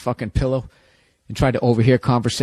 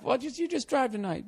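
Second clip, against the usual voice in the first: boyfriend, mock high; 10 semitones higher, 7 dB quieter than usual. Boyfriend voiced in breakup bit.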